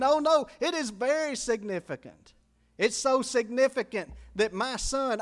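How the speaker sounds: loud, high, urgent sermon voice; preaching at full force. Acted. Preacher urging lost listeners to repent, loud and urgent. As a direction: preach hard and urge them to repent.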